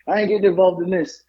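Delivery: imitating an emphatic hands-off protest; vocal refusal. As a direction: declare it for someone else to overhear. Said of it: "Very vocal about it, so she hears".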